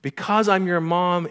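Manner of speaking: high, loud, emphatic retort; interrupting mother. Retold or imitated. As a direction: cut in with motherly conviction. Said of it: "she interrupts him".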